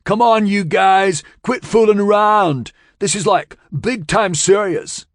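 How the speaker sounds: high, loud call for help; desperate yell across the mud. A narrator's reading. Still yelling at land ahead.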